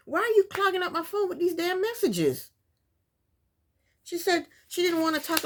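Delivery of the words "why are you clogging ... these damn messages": mock annoyed rant. Ranting at texts she'd hate.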